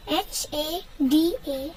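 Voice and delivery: slow, letter-by-letter spelling; spelled out. Shown as a demonstration. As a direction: spell it slowly for learners, pausing after each letter. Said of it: "teach you how to pronounce it".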